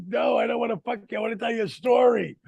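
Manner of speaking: rapid excited plea to a sleepy wife; excited rush. Retold or imitated. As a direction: blurt it out too hyped to wait. Waking his wife, excited.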